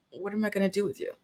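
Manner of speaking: soft, quick, resigned mutter after a sigh; fond, weary giving-up; mock exasperation. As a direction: say it under your breath, lightly despairing. Retold weary reaction after a sigh.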